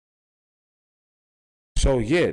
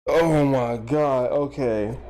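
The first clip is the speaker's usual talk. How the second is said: breathless after laughing; 3 semitones higher, much slower than usual. Real, not acted. Recovering from laughing fit.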